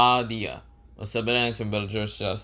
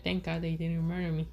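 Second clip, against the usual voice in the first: high, hushed relief; Boruto; 6 semitones higher, 9 dB quieter than usual. Acted. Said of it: "Boruto said".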